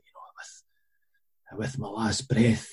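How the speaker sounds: hushed, breath-only start, very soft; imitated faint dramatic farewell; dying last words. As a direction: whisper it like a dying film hero. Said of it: "at the end, when Chow Yun Fat".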